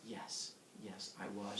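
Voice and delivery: low, hushed suave god voice; suave Zeus. Retold or imitated. Zeus reveals himself to the girl, low and suave. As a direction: confess it softly, down low. Acts Zeus's smooth low reply.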